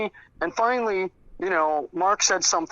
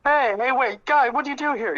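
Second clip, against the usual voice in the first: high-pitched mock alarm; retold startled protest; 10 semitones higher than usual. Mark finds himself tethered by a prank, retold startled protest. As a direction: protest in a raised, imitating voice.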